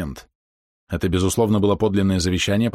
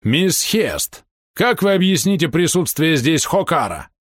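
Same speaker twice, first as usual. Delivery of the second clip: high, slow, emphatic demand; indignant demand; 9 semitones higher, slower than usual. A narrator's reading. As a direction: demand an explanation on the spot. Полковник требует объяснений. (возмущённо, требовательно)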